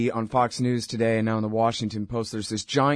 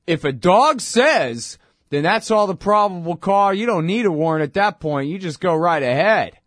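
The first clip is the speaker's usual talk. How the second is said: higher, louder mocking judge voice; mock Supreme Court; 6 semitones higher, 6 dB louder than usual. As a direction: lampoon the court as a breezy know-it-all. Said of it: "the Supreme Court has said".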